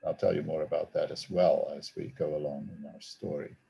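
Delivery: normal talking voice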